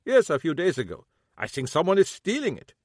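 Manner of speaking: lightly voiced old German man, bitter; old German shoemaker, a hint of German accent; German-accented cobbler. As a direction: grumble it with a German accent, grieving.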